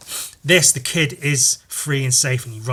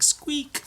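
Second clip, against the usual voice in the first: very high little squeak; tiny high-pitched rodent noise; rat squeak; 13 semitones higher, 4 dB quieter than usual. Retold or imitated. Imitating the rat.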